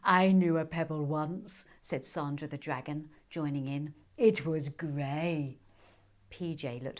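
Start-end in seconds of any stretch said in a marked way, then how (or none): 0.0-1.6 a lightly voiced solemn dragon. Sandra the dragon voice. 4.1-5.6 very slow, weighty dragon line; slow solemn dragon. Acted. Sandra the dragon voice.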